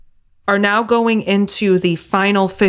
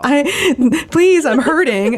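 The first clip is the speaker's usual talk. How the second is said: high, pleading voice of a worn-out car; pleading race car; 7 semitones higher, much slower than usual. Retold or imitated. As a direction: beg the driver to stop, voicing the car. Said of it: "that car was like".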